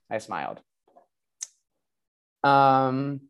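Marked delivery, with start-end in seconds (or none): 0.1-0.6 much softer, dropped low; hushed repeat. Shown as a demonstration. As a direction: whisper it back to yourself.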